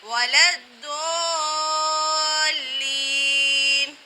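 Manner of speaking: وَلَا الضَّالِّينَ chanted slowly with a thick, rounded "Doo"; heavy Dad. Shown as a demonstration. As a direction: hold the heavy ض very long in الضَّالِّينَ. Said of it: "ضاد پر ہمیں ضاد ہی ادا کرنا ہے". (موٹے ضاد سے کھینچ کر تلاوت)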